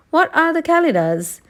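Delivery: put-on a young girl asking; Dorothy voice. A narrator's reading.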